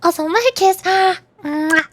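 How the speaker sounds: gasp, then a big "mwah"; blown kiss. Pretend. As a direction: announce and smack a kiss. Performs a kiss sound.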